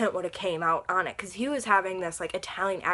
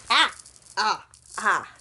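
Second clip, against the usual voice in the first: startled yelps; 5 dB louder, slower than usual. Real, not acted. Swinging necklace, startled.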